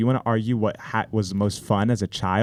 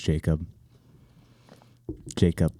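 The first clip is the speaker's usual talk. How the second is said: JACOB set apart by long pauses, low and pointed; stress on JACOB; 6 semitones lower, 4 dB quieter than usual. Real, not acted. Man vowing revenge on his rival, pointed stress on Jacob. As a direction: drop low and lean on "Jacob". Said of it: "Emphasis on the word Jacob".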